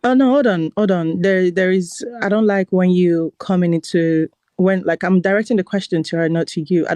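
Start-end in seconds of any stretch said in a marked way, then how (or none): none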